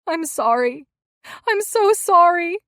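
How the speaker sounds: loud, high sobbing apology; wife sobbing over her husband. A narrator's reading. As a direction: break down crying. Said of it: "She sobbed".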